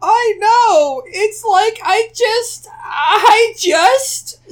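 very high, loud tearful whine; slow squeaky wail, fighting fake tears; mock choked-up crying. A narrator's reading. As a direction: struggle through it, hammed-up near sobbing. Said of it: "trying not to cry".

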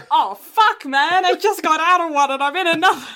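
loud, high, laughing complaint; exasperated laughing outburst. Retold or imitated. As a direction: blurt it out in laughing dismay. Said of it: "So you're just like".

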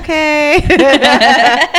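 amused okay through laughter; laughing okay. Retold or imitated. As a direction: let the okay break into a laugh. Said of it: "It's like".

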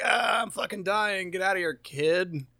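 lightly acted dying groan; mock dying soldier. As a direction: imitate a wounded soldier sending a kid away.

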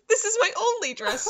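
high, loud mock squeal; hammed-up excited girl; giddy shriek. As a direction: squeal it out, thrilled. Erica realizing she owns one dress.

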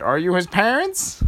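put-on high, questioning old lady voice. Old lady quizzing the princesses, high and nosy. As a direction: peer down at them suspiciously. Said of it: "the old lady said".

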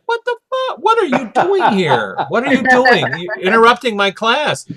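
high, shocked and indignant; shocked outburst. Retold or imitated. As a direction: burst out at an intruder, pitch jumping high. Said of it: "she's like".

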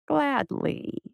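soft, drawn-out purr. A narrator's reading. Voice accepts her plea, satisfied purr. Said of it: "the voice purred".

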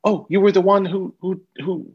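high, gushing excited fan. Retold or imitated. Fan gushing at the stage door, high and excited. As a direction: gush like a starstruck fan.

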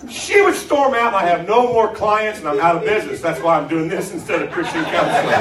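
loud, high preaching with laughs; fired-up preaching. Acted. Preacher to congregation about buttons, loud and laughing. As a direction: shout it at the congregation with a grin.

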